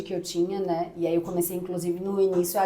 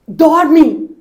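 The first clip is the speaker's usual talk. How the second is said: a sudden loud, higher snap of an order; retold yell; 7 semitones higher, 13 dB louder than usual. Exhausted mother to her crying baby, loud, desperate retold yell. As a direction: bark one word at the baby, at the end of your rope.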